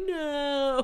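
put-on scared kids. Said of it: "They're like".

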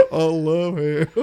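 giggling through a mock gush; laughing mock believer. Friends joking about a Bigfoot believer, laughing. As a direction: laugh while gushing over him.

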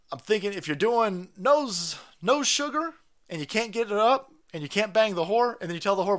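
ordinary talk

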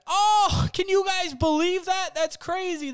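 high, loud, fake-amazed host voice to the crowd; hammed-up James Corden turning to the crowd; mock talk-show host. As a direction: play to the audience like a cheesy host. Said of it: "looks at the crowd and he's like".

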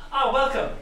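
bright, high shop welcome; shopkeeper greeting. Acted. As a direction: greet the customer cheerily. Shopkeeper Tiffany greets customer.

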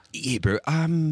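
teasing New Zealand voice; mock New Zealand accent. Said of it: "make fun of his accent".

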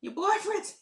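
high-pitched mock calling out; imitated yell. Steve Harvey teasing Monica about her boyfriend, mock yell. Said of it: "Steve Harvey yells".

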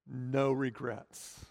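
very soft, slow, almost breathed; near-silent reverent quote; hushed final words. Acted. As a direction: let the last words fall away quietly. Dying man's final words.

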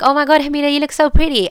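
high, loud excited friend; gushing compliment. Retold or imitated. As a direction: gush over how pretty she looks.

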